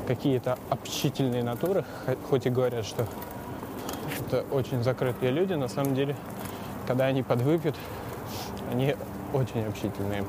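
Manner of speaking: usual speaking voice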